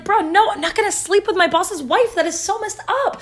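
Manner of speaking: high-pitched, protesting refusal; exasperated refusal. Retold or imitated. Joseph turns down his boss's wife, exasperated. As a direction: push the pitch up in mock exasperation.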